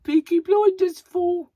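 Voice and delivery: high, loud mock Brummie; exaggerated Birmingham accent. Shown as a demonstration. Vlogger teasing after apologising to Birmingham, exaggerated Brummie accent. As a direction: ham up a broad Birmingham accent. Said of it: "terrible exaggeration of their accent".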